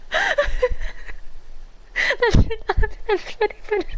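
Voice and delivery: high, cracking giggles through every word; can't stop laughing, real and uncontrolled; helpless laughter. Real, not acted. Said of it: "that was a good laugh".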